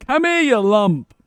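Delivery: high, loud call after a runaway dog. Real, not acted. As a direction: shout after him to come back. Calling the dog as it runs off.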